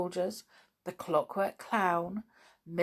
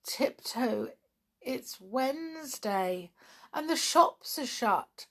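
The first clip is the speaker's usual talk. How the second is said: ordinary delivery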